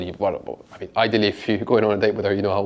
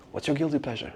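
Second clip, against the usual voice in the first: softer, coaxing, like a secret; teasing hush; 3 semitones higher, 9 dB quieter than usual. Shown as a demonstration. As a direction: lean in and ask it softly. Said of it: "Just whisper in your ear".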